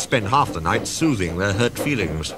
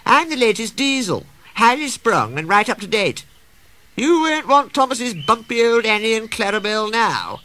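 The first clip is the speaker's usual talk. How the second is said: high-pitched show-off; boastful, preening Daisy; 13 semitones higher, 3 dB louder than usual. A narrator's reading. Daisy woos the waiting passengers, boastful. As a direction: sing your own praises, very pleased with yourself.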